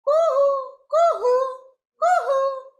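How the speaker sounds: high, loud two-note bird call; koel call imitation. Shown as a demonstration. Teacher shows children how a koel calls, high loud imitation. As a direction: sing out a cuckoo's "coo-hoo". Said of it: "કેવી રીતના બોલે કોયલ?".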